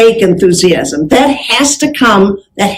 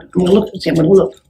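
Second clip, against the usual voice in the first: 1 private mutter; 3 semitones lower, 6 dB quieter, faster than usual. Real, not acted. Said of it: "Make sure you don't see".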